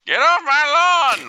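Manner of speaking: loud, high, grumpy yell; cranky old man shout. Pretend.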